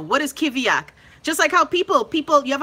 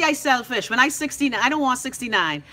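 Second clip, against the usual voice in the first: ordinary delivery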